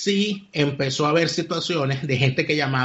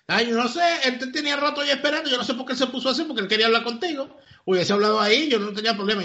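voz aguda de recepcionista que se excusa; recepcionista desconcertada; 7 semitones higher than usual. Retold or imitated. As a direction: imita a una recepcionista perpleja, en tono mucho más alto. (recepcionista que explica lo del señor, desconcertada, en voz aguda)

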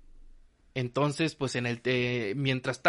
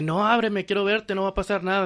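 higher-pitched, lightly imitated child's plea; pleading son; 6 semitones higher than usual. Son urges his mother to open the door, lightly imitated pleading. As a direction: lightly voice a boy begging to be let in.

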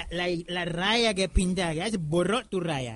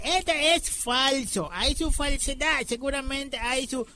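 slow mock suspicion; 4 semitones higher, slower than usual. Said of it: "yo le dije".

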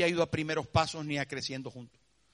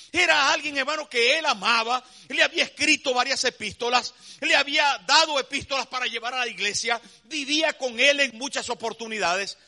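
louder, higher preaching build; 6 semitones higher, 7 dB louder than usual. Acted. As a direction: press it home from the pulpit. Preaching at full force. (predicando con fuerza)

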